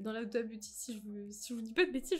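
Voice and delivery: ordinary talk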